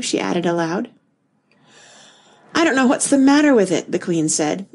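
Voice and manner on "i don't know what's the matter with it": mildly plaintive, high-pitched lament; melancholy. A narrator's reading. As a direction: complain with a touch of melancholy, pitched up. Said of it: "in a melancholy voice".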